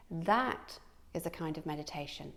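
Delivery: ordinary delivery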